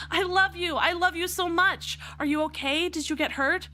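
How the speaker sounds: put-on high, eager girl greeting her dad; bright teen voice, thrilled he's back; excited daughter. Daughter greeting her returned father, high and overjoyed.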